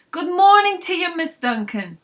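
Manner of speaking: high, loud, cheery call across the counter; Frank shouting a greeting. Acted. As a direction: hail her as she walks in. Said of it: "shouted out as I walked in".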